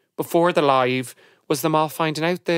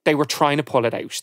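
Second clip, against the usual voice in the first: usual speaking voice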